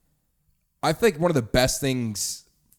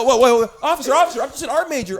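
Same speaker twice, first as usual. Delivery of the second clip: much higher, louder pleading squeal through laughter; panicked art major; 9 dB louder than usual. Acted. Art major pleading with officer, high and panicked.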